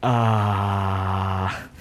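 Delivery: soft, low awestruck "ah"; a quiet stunned breath of awe; awed gasp. Retold or imitated. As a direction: let the jaw drop in wonder. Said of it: "jaw-dropping".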